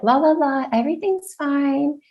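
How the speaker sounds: high, airy la la la; mock carefree singsong. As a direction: brush it off like nothing's wrong. Siblings ignoring her, mocked.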